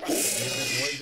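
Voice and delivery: loud startled complaint; alarmed outburst. Real, not acted. Player hearing the noise again, alarmed and loud. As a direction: blurt it in alarm.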